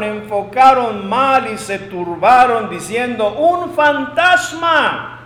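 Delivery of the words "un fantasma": high, drawn-out cry of fear; grito de miedo. Acted. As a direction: act out frightened men crying out, slowly. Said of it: "dieron voces de miedo". (grito de miedo actuado, agudo y alargado)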